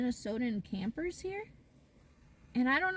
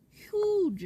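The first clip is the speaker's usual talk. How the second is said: Huge said high and loud; emphatic huge; 10 semitones higher, 8 dB louder than usual. Real, not acted. Stressing to viewers how big the states are, a loud high Huge. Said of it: "are huge".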